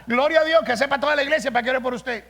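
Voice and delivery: loud, fast, high exclamation of faith; a pastor firing back a triumphant answer; bold retort. Retold or imitated. Pastor retorting to a church member, loud and quick.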